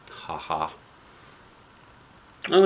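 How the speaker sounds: ordinary talk, nothing marked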